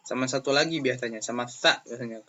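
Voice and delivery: normal talking voice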